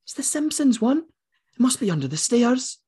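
high-pitched mum voice, slowing at the end; squeaky flustered mum; high mum impression. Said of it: "my mum went".

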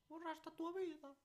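sudden high mock-dramatic voice. Warning his friend about the exam in a high squeal.

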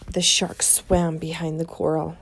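usual speaking voice

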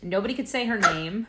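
ordinary talk

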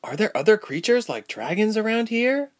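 put-on a curious girl asking about creatures; Ilse's voice. A narrator's reading. Ilse asking the stablehand about creatures, lightly voiced.

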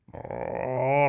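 drawn-out grumbled "oh"; grumbling wolf. A narrator's reading. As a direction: grumble it out in the wolf's voice. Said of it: "refunfuñó el lobo". (refunfuño de lobo)

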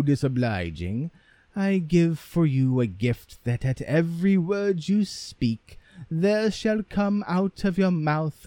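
stately fairy delivering a doom; fairy's curse. Acted.

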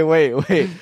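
usual speaking voice

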